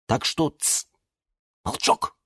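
near-whispered shush; soft, secretive warning; hushing. A narrator's reading. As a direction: hush them conspiratorially. Owner hushes his plants.

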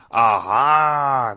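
loud troll roar. Acted. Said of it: "громко взревела она".

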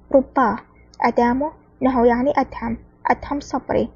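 usual speaking voice

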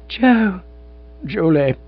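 high delighted calling of names; overjoyed, calling each other; joyful cries. A narrator's reading. Reunited old sweethearts, delighted high cries.

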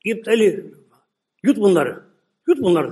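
loud prophet's command; Musa shouting. Acted. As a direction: shout at the ground to swallow them. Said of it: "Musa bağırdı".

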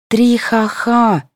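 high, very drawn-out fake laugh words; mocking laugh. A narrator's reading. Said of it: "засмеялась Таня".

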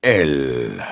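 Snape's low snarl; growled. A narrator's reading. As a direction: spit it out with loathing. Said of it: "gruñó mirando a Sirius".

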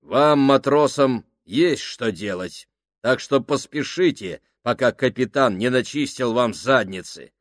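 loud, firm orders to the crew; commanding. Acted. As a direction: bark orders like a sergeant.

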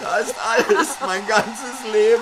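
high, slow lament; mock despair. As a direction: mourn the phone like a life. Klagt um sein Handy. (hohe, langsame Klage)

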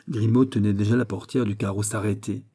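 plain reading voice

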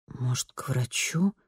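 soft, slow self-talk; quiet to herself. A narrator's reading. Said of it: "тихо сказала она сама себе".

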